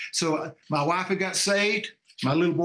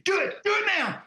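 screaming demons; 13 semitones higher than usual. Retold or imitated. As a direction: imitate demons urging, voice jumping way up. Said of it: "the demons are screaming".

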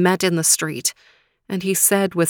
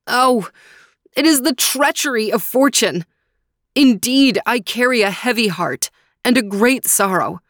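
raised, heavy-hearted voice of grief; pitched up and mournful; sorrowful Faraz; 5 semitones higher, 3 dB louder than usual. Acted. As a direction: lament the treachery of fortune. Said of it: "Faraz wept tears of sadness".

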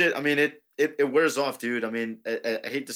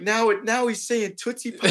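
mock fans; 11 semitones higher than usual. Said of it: "everyone was like".

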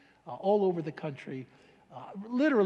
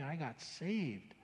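quiet awe; 3 semitones lower, 10 dB quieter, much slower than usual. Real, not acted. Recalls his conversion.

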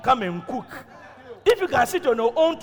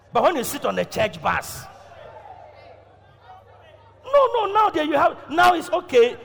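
usual speaking voice

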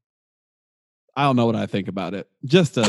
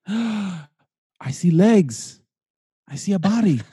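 awed gasp; 7 semitones higher, slower than usual. Pretend. Mock awed seer.

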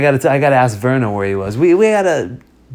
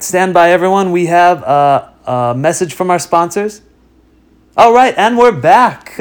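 announcer voice; 3 semitones higher, 7 dB louder than usual. Pretend. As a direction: announce it like a radio host. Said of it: "announcement from the sponsors".

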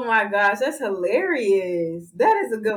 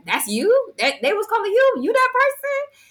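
rising, incredulous questions, higher and higher; shocked classmates; 13 semitones higher than usual. Retold or imitated. Classmates recognizing her on camera, stunned and high. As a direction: gasp it out in disbelief. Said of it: "everybody was just like".

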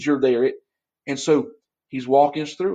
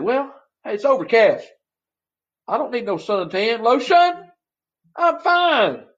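lightly voiced breezy beachgoer, jumping higher at the end; mock beachgoer; 8 semitones higher than usual. Pastor mocks beachgoer on overcast day, breezy voice. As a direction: play a carefree fool skipping sunscreen.

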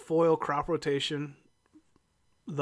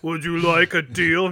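mock demon offering a bargain; playful imitation of a demon; 2 semitones higher, 4 dB louder than usual. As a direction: do a hammed-up demon tempter. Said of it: "that's a demon voice".

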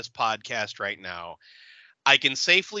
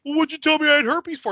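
much higher and fast, hammed-up whine; whiny mock complaint; 12 semitones higher, 5 dB louder, faster than usual. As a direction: rattle it off like a squealing offended dude. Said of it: "he's like".

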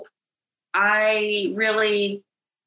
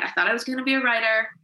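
ordinary talk

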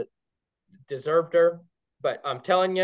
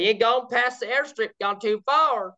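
higher put-on Kentucky country voice; imitated local woman; 6 semitones higher than usual. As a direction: imitate her local accent, pitched up. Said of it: "she said".

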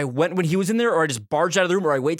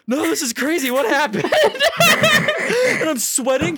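high, nervous, laughing denial; high nervous fake-innocent voice; feigned innocence; 9 semitones higher than usual. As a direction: play dumb through nerves.

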